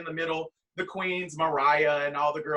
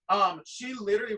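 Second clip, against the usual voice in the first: normal talking voice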